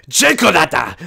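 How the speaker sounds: loud, high furious outburst; angry shout. Acted. Old lady shouting at Kickflip before throwing the spoon. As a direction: yell it out in a rage. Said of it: "just goes".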